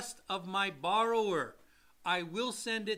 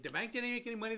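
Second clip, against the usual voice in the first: normal talking voice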